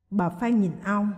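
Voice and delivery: ordinary delivery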